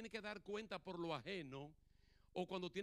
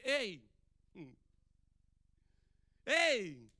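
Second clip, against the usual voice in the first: loud call, the second louder; 10 dB louder than usual. Retold or imitated. God calling to Moses from the burning bush, loud. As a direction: call out across the desert.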